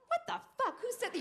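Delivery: very high shocked outburst; startled awake. Retold or imitated. Woken by the alarm at six, shrill outraged shock. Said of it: "you're like".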